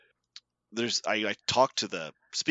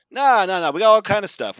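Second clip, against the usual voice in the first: higher and louder, pushing; insistent worker; 7 semitones higher, 8 dB louder, faster than usual. Retold or imitated. Worker brushes off their small order, pushy and loud.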